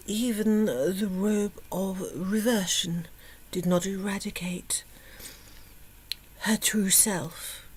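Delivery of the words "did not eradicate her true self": soft unvoiced whisper. A narrator's reading. Narrator whispers the reveal, softly. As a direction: hush it like a secret.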